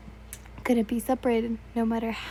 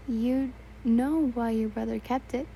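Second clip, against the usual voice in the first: ordinary delivery, nothing marked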